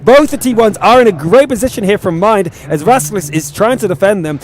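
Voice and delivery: excited, driving match commentary; live esports play-by-play. Real, not acted. Caster calling the shots to viewers, excited play-by-play. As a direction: call the action as it happens, urgently.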